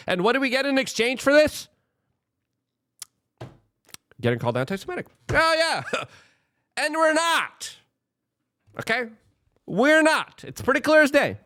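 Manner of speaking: sarcastic high mock. Real, not acted. Sarcastic mocking rant.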